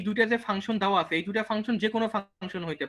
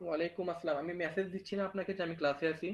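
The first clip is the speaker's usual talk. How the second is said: hushed phone voice, much softer; whispering on the phone; 3 semitones lower, 11 dB quieter than usual. Real, not acted. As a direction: keep it low so the class won't hear. Takes a phone call mid-class.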